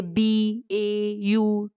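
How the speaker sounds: बी ए यू spelled slowly, each letter apart; spelling out letters. Shown as a demonstration. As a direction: spell बी ए यू out one letter at a time. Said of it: "जिनको break किया जा सकता है". (बी ए यू को एक-एक अक्षर धीरे-धीरे बोलें)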